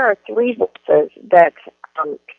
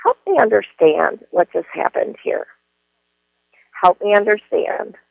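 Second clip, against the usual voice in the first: ordinary delivery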